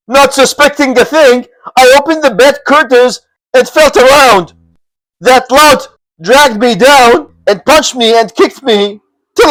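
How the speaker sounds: a battered bandit pouring out his grievance; aggrieved lieutenant. Acted. Beaten lieutenant telling his chief the tale, aggrieved.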